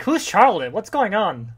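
high, loud bewildered cry; alarmed confusion. Retold or imitated. Abby bursting in on the basement, loud and bewildered. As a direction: sound lost and shocked, loud. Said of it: "Abby's like".

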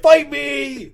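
high, loud playful holler; mock challenge shout. Acting out Thor jumping on Dracula, high mock shout. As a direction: yell it as a hammed-up dare.

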